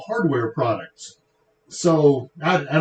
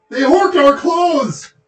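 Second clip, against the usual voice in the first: movie quote shout; 12 semitones higher, 7 dB louder, slower than usual. Retold or imitated. Strange Brew movie quote shouted.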